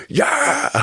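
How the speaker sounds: high, whooping group yeah; crowd cheer. Retold or imitated. Fifty wharf rats cheering the boy, high whooping yeah. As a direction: cheer like a roomful of friends.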